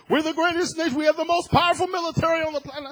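high, loud, fast parody of bragging; mocking boast. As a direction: ham up the empty national boast. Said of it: "I've heard".

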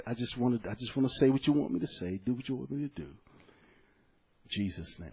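hushed, intimate prayer voice, fading to almost nothing; quiet prayer. Retold or imitated. Preacher reciting his private morning prayer, hushed and devout. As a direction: pray softly as if alone before work.

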